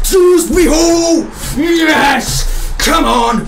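very high, loud triumphant yell; goal celebration shout. Real, not acted. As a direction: roar it out as the goal goes in.

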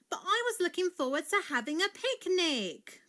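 put-on high, loud, disappointed child; crying out. A narrator's reading. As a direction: wail in protest over a lost treat. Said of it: "cried Daisy".

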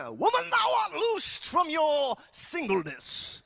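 slow, high, booming preacher shout; hammed-up deliverance command, loud and stretched; mock bold proclamation. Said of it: "I was not that confident".

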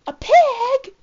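loud, high astonished exclamation; surprised reveal. Acted. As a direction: burst out in disbelief. Pig pulled out of George.

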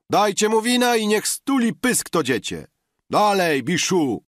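high, loud shouts over the din; rowdy calling out. A narrator's reading. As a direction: egg him on and yell down the noise. Biesiadnicy przekrzykują się, wołają Biszu. (głośne, wysokie pokrzykiwanie biesiadników)

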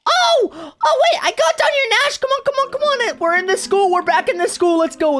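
high excited yelling; excited shout. Real, not acted. Found the way into school.

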